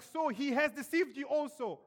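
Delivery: an angry court official rounding on his guards; furious accusation. Retold or imitated. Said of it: "He was furious".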